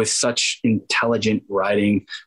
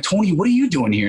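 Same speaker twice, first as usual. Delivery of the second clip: high-pitched delighted disbelief; retold astonished reunion; surprised greeting; 9 semitones higher than usual. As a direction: react to an old friend turning up. Acted surprise meeting childhood friend.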